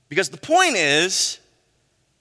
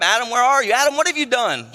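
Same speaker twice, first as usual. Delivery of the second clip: God searching the garden, calling for Adam; 5 semitones higher, faster than usual. Acted. God calls through the garden for Adam, raised voice.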